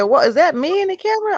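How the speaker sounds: high, startled question; shocked disbelief. Retold or imitated. Seeing her own face on the camera, shocked and high. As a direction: gasp it at your own reflection.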